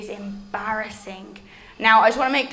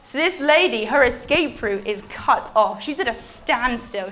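ordinary delivery

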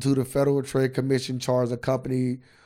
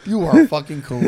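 laughing; 6 semitones higher, 9 dB louder than usual. Real, not acted. Laughing at friend's joke.

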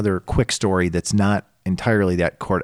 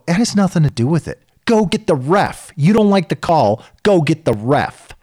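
higher, insistent retold rebuke; retold firm rebuke; 8 semitones higher, 4 dB louder than usual. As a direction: push back hard and repeat the order. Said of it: "I go".

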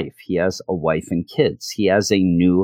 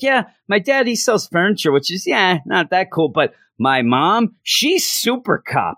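put-on bragging kid; 9 semitones higher than usual. Doug bragging to party kids that his mom is a super cop, high proud kid voice. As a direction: boast about mom as if she's a superhero.

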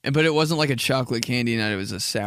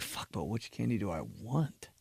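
muttered dilemma; 3 semitones lower, 11 dB quieter than usual. Retold or imitated. Torn over candy choice.

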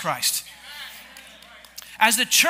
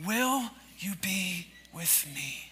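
soft group repeat; 6 semitones lower, 15 dB quieter, slower than usual. Shown as a demonstration. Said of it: "and say it with me".

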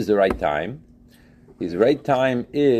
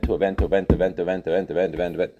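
rattled-off list; 4 semitones lower, much faster than usual. Shown as a demonstration. Listing events on autopilot.

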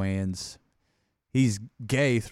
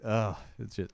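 ordinary talk, nothing marked

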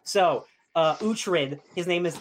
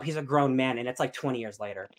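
ordinary talk, nothing marked

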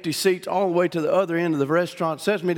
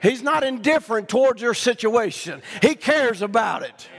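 full-force preacher cadence; preaching; 7 semitones higher than usual. Real, not acted. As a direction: bring it home to the congregation.

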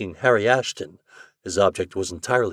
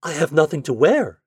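protesting; 5 semitones higher, 4 dB louder than usual. Acted. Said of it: "she protested".

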